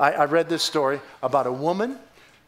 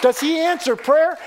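loud, high praise shout; exultant preaching; 12 semitones higher, 5 dB louder, slower than usual. Real, not acted. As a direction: cry praise out to the congregation. Preacher exults after a conversion story.

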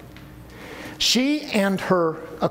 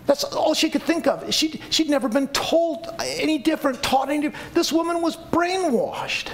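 mocking quote; 8 semitones higher than usual. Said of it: "Do you know what he said?".

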